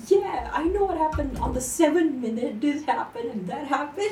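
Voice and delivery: high, loud eager retelling; can't-shut-up excitement. Retold or imitated. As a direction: rattle off the highlights, high and excited. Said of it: "I just wouldn't shut up about it".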